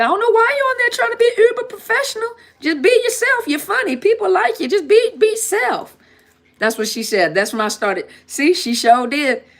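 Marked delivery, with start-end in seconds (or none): 0.0-6.0 much higher made-up voice for a scolding friend; made-up Tasha voice. Retold or imitated. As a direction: squeak it way up like a nagging old friend. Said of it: "Tasha don't actually sound like that".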